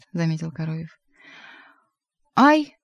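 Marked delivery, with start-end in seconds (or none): 2.3-2.8 sudden high, loud yelp; high-pitched outcry; crying out. A narrator's reading. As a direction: cry out sharply like a startled cat. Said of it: "вскричал бегемот".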